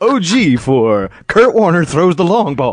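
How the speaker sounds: slow, grand awards presenter; awards show announcer. Pretend. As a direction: announce the winner slowly with pomp. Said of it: "he's accepting an award".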